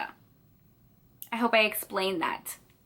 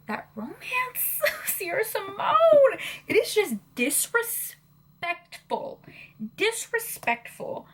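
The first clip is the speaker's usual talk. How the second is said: high, slow, near tears; overcome, half laughing half crying; tearful laugh; 9 semitones higher, slower than usual. Real, not acted. Emotional over the book.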